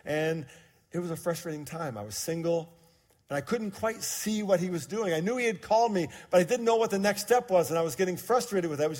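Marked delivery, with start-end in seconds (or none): none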